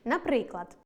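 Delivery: normal talking voice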